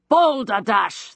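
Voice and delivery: slow, hot-blooded blast; explosive outburst. A narrator's reading. Geoffrey explodes at the girl, slow and furious. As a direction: spit the word out in fury. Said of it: "the hot-blooded Geoffrey exploded".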